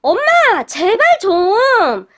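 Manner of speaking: high, loud startled cry, drawn out at the end; startled yelp. A narrator's reading. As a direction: jump out of your skin and protest. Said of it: "깜짝 놀란 도련님이".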